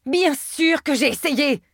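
high, drawn-out exasperated cry; pained cry. A narrator's reading. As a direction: cry it through a spasm. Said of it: "cria-t-elle".

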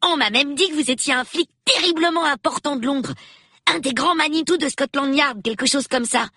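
put-on high, quick child's voice; eager young boy. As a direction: pitch it up like an inquisitive boy. Reader voices young Peter. (voix aiguë de gamin curieux)

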